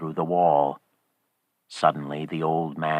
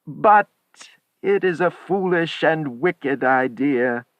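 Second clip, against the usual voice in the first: high, raised protest, only nominally weak; 10 semitones higher, 4 dB louder than usual. A narrator's reading. Old man objects to his wife's wish, weak protest. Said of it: "he said weakly".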